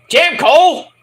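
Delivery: high, loud mock-outraged shout; exclaimed reproach. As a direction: call him out at the top of your voice. Own shouted reaction to commentator.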